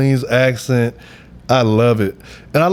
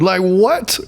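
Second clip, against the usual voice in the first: ordinary talk, nothing marked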